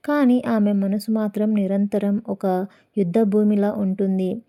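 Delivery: usual speaking voice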